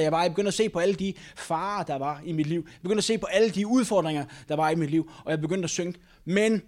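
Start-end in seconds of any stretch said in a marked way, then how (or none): none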